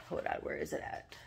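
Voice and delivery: whispered to herself while searching; a quick whisper under her breath; whispering while hunting for the page; whispered aside. Real, not acted. Searching her notebook mid-video.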